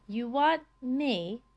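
slow, puzzled, drawn-out words; slowly spelling it out. Acted. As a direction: work it out word by word. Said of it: "spelling out this new thought to himself".

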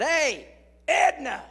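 high, loud call across the bleachers. Retold or imitated. Calling Edna over on the bleachers, loud greeting. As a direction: call her name boldly.

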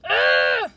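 loud exasperated groan; frustrated ugh. Real, not acted. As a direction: let out a big fed-up ugh. Frustration over book plot.

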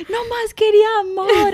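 put-on very high whimpering kid voice; whiny crying child. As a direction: mimic a wailing toddler. Child crying, mother imitates.

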